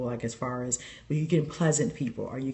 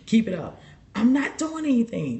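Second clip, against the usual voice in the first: sassing the grandmother, pushing it higher each line; defiant back talk; 7 semitones higher than usual. Retold or imitated. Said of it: "And I say".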